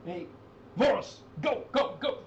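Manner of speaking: put-on old man shouting orders, pitched high; urgent high call to the guard. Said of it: "he goes".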